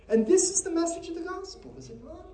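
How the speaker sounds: high, loud preacher's cry; rising sermon climax, slow and strong; preaching at full force. Acted. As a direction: lift the congregation, proclaiming.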